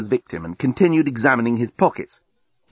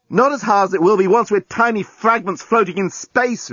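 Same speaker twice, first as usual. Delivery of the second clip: high-pitched emphatic comeback; retort; 9 semitones higher than usual. A narrator's reading. Journalist answers complaint about breathing, sharp retort. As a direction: argue back urgently, hitting the key words. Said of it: "retorted the journalist".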